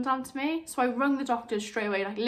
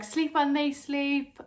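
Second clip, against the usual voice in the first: higher, slow sing-song advice; mock advice; 5 semitones higher, slower than usual. New mums told to sleep, mock sing-song advice. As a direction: mimic the advice everyone gives. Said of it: "they say".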